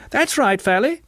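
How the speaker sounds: high-pitched crowd jeer; high, excited cheer; crying out. A narrator's reading. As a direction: cry it high, egging him on. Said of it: "cried the Clevers".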